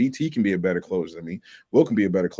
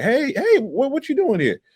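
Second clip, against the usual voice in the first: much higher, louder startled call; startled confrontation; 3 dB louder than usual. Retold or imitated. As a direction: blurt it out high, catching someone red-handed. Retold confronting of own customer.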